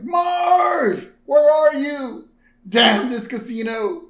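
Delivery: high, loud calling out; mock yell. Homer yells for Marge in the casino, high and loud. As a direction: shout across the casino floor.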